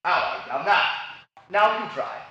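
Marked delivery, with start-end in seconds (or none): 0.0-0.8 lower, contained Cockney greeting; Cockney accent. Shown as a demonstration. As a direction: demonstrate a neat Cockney accent. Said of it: "Let's try the Cockney then".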